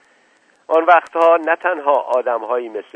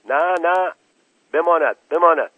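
raised, urgent shout of refusal; 6 semitones higher, 5 dB louder than usual. Acted. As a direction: call out sharply to stop him. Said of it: "مفتش تأمینات فریاد زد".

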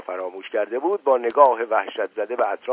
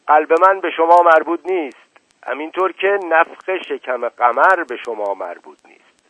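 louder, higher angry retort; 6 semitones higher, 7 dB louder than usual. Acted. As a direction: cut the doctor down with anger. Uncle rebukes the doctor angrily. (پاسخ تند و خشمگین)

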